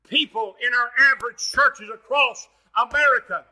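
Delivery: shouted, high-pitched revival preaching; full-force preaching. Acted.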